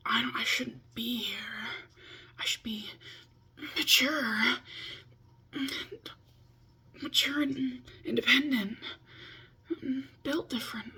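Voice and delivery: mock the whiny rebellious teen in a hushed high voice; hushed, dramatic inner teen. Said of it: "rebellious teen that's like".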